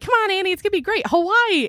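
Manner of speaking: high-pitched mock cheerleading; stoked, excited. Imitating partner selling the move to Hawaii, excited. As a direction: coax with hammed-up, high enthusiasm. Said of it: "you were so stoked and excited".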